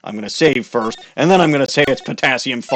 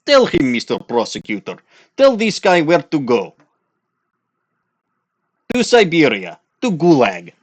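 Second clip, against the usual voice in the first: higher, louder hammed-up Russian accent; mock Russian tough guy; 6 semitones higher, 4 dB louder than usual. [name] scoffing at the defense lawyer, in a hammed-up Russian accent.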